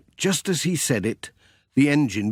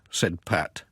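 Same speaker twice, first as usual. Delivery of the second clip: usual speaking voice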